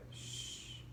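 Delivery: soft unvoiced shush; gentle shh to calm a crying father; soothing shush. A narrator's reading. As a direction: hush him like a frightened child. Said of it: "like he was a scared little kid".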